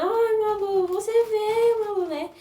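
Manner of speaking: put-on high, crying voice greeting the baby; reliving the tears. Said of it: "Aí eu ficava chorando". (revivendo o choro ao ver a filha, voz aguda e chorosa)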